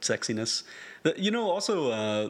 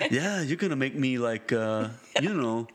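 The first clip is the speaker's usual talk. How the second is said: [name] impression; 5 semitones higher than usual.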